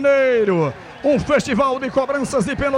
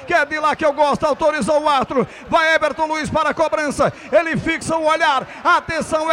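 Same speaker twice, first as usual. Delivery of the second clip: ordinary talk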